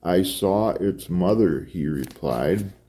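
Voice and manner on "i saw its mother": plain reading voice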